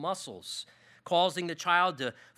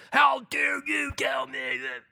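retold outburst, high and loud; angry shout; 7 semitones higher, 4 dB louder than usual. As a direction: shout it back like the furious counselee. Angry counselee confronting the pastor.

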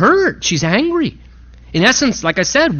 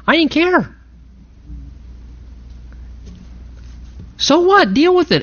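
defiant; 9 semitones higher than usual. Real, not acted. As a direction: throw it out as if daring anyone to object. Defiant about causing a scene.

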